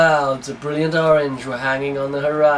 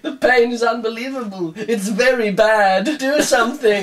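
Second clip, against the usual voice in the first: hammed-up dying man; 7 semitones higher than usual. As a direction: overplay the dying terrorist's pain. Hammed-up dying terrorist, high.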